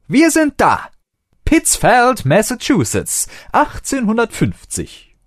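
high, loud, thrilled arrival call; joyful announcement. A narrator's reading. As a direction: announce it like a proud tour guide. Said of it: "Freudig drückte der Doktor". (freudige Ankündigung)

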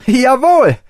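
high, loud, gleeful jawohl; delighted cheer. A narrator's reading. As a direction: burst out in joy. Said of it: "freute sich der Doktor". (begeistertes Jawohl)

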